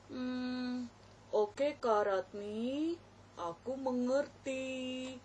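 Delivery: slow, meek puppet voice; child puppet voice. Acted. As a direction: answer the teacher like a shy small boy. Puppet Bogan answers teacher.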